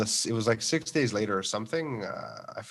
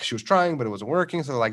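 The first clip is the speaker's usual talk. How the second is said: ordinary talk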